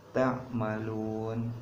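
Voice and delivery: Ta'malun very slow, long vowel held before the final stop; lengthened stop at end. Shown as a demonstration. Teacher shows pausing at verse end, very slow. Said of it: "Contohnya seperti ini bacanya ya".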